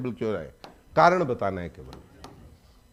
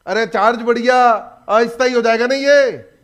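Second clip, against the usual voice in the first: emphatic correction; 4 semitones higher, 7 dB louder than usual. Real, not acted. Teacher correcting students emphatically.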